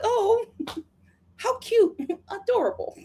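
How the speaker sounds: high, amused cooing; charmed mock cooing. American charmed by a British insult, high mock cooing. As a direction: coo as if the insult were sweet. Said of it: "when a Brit is saying an insult, it's still like".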